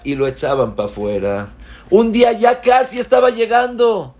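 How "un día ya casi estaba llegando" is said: very high and loud urgent telling; excited climax. A narrator's reading. Preacher telling how the boy nearly got in, high and urgent. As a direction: reach the peak of suspense.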